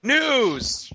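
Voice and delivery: high, loud, drawn-out "news" announcement; segment call-out. Pretend. Co-host announcing the news segment, high loud call-out.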